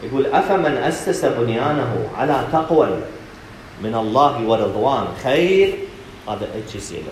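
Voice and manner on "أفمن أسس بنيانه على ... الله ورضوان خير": measured, drawn-out verse recitation; Quranic recitation. Acted. Preacher reciting the verse to his audience, measured recitation. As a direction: recite the verse solemnly, stretching the long vowels.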